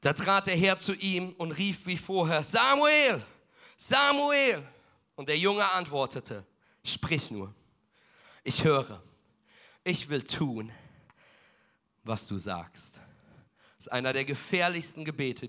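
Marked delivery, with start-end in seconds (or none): 2.4-4.7 higher, louder call of a name; a reverent divine call. Acted. Bible reading of God's call. 6.8-13.0 put-on a hushed, reverent boy; humble answer. Boy answers God, read.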